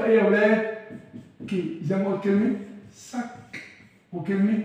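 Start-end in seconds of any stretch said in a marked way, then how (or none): 2.9-3.6 "سك" ending in a clear, hard K; final C as K. Shown as a demonstration. Teacher showing how final C sounds, "سك" with hard K. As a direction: release the last sound of "سك" as K. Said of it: "حرف السي بيتنطق كي".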